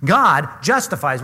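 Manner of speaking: "God" stressed, every word spaced out slowly; slow, weighty "God justifies the wicked"; slow emphatic quote. Shown as a demonstration. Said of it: "critically important phrase".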